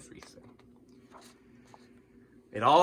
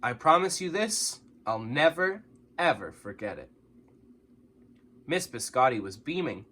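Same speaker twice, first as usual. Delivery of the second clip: ordinary delivery, nothing marked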